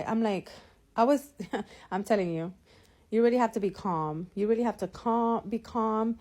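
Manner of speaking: ordinary delivery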